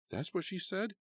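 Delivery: high incredulous question; stunned. A narrator's reading. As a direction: disbelief at the accusation. Karen stunned by accusation.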